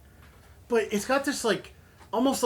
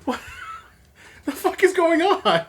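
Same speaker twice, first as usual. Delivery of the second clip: laughing; 12 semitones higher, 8 dB louder than usual. Real, not acted. Reacting to numbing beer.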